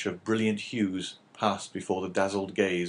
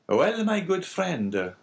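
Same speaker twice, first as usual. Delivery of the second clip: slow, higher, mildly indifferent character voice; indifference; 10 semitones higher, 4 dB louder, much slower than usual. A narrator's reading. Abbé letting the innkeeper choose, mildly indifferent. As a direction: concede it as if unconcerned. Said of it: "in a tone that indicated utter indifference".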